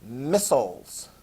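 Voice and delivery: "Missiles." said slowly the American way, MISS-uls with a short second syllable; American pronunciation. Shown as a demonstration. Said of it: "And I pronounced it that way".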